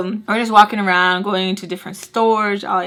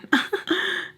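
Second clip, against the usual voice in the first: breathy giggle; 8 semitones higher, 5 dB quieter than usual. Real, not acted. Laughing at own confusion.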